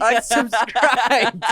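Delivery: high, very slow mock verdict; drawn-out teasing sign-off. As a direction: stretch the verdict out like a game-show host.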